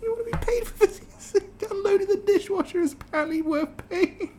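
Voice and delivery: high squeaky voice breaking into laughter; laughing in disbelief. Real, not acted. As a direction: laugh it off as nonsense. Laughing at article.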